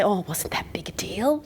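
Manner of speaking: breathy whisper, shrugging it off; whispered self-deprecation. Retold or imitated. To the audience, speaker whispers how girls downplay success. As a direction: whisper it like it's nothing. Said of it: "You say".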